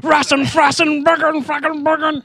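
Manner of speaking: put-on high, loud hammy old-timer gibberish; old prospector. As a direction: babble like a grizzled cowboy. Joins the old cowboy bit.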